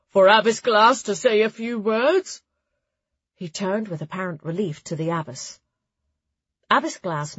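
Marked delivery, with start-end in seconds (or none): 0.1-2.4 loud, higher-pitched formal announcement; droning high priest. A narrator's reading. High Priest handing over to the abbess, droning loudly. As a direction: drone the handover out to the whole hall. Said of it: "the High Priest droned out his introduction".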